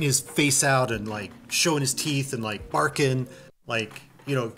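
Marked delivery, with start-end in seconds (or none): none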